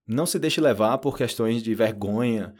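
ordinary talk

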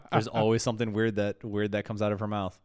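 ordinary delivery

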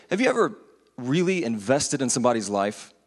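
ordinary talk

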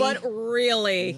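slow, drawn-out incredulous "really"; loud, dragged-out mock disbelief. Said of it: "I'm gonna be like".

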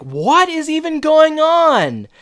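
very high, loud, slow; mock exasperated wail. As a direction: imitate a bewildered outburst, stretched out. Retold bewildered exclamation.

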